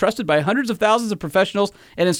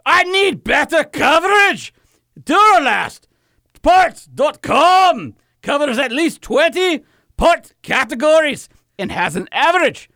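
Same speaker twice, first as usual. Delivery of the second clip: a much higher, louder, slow mock Scottish shout; yelling mock Scot; 10 semitones higher, 3 dB louder, slower than usual. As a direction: yell the whole ad read as a hammed-up Scot. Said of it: "you're just yelling at me".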